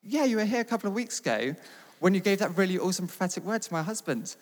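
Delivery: high, loud imitation of an eager wife; imitating someone thrilled to recognise you; retold excited recognition. Leader's wife insists they met, retold.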